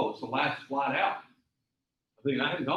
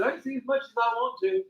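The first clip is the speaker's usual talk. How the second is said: higher, defiant reply; stubborn high retort; 11 semitones higher than usual. Retold or imitated. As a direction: dig in, pitched up. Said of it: "I said".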